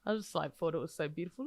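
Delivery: usual speaking voice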